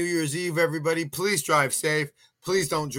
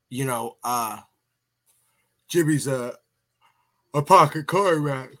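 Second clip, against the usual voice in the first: yawning; 4 dB louder than usual. Real, not acted. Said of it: "I don't know why I'm yawning so much".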